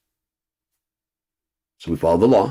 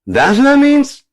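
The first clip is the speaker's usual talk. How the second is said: high, loud, slow mock-dumb shout; dumb American; 8 dB louder than usual. Mocking Americans who misuse words, high loud disbelief. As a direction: squeal it like a clueless American.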